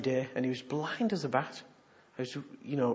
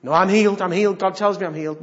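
stubborn claim; 4 semitones higher, 10 dB louder, faster than usual. Retold or imitated. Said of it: "you know".

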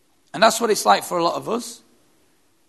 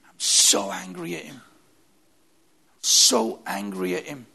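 grieving widow. Retold or imitated. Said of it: "she says".

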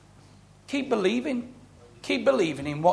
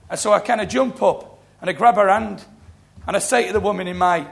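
usual speaking voice

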